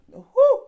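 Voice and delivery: high, loud exasperated whew; a loud whew of disbelief. Real, not acted. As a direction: let out a big disbelieving whew. Reacting in disbelief.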